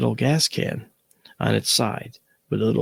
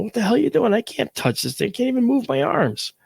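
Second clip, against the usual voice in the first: retold alarmed protest; 12 semitones higher than usual. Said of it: "I'm going".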